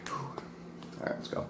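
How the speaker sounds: breathless whisper, bracing yourself. Real, not acted. Bracing to eat hot wing.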